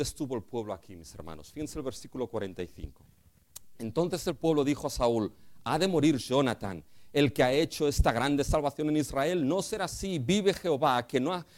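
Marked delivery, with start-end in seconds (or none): 3.8-11.5 preacher building to full force; fiery preaching. Acted. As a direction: drive the point home from the pulpit. Preaching climax.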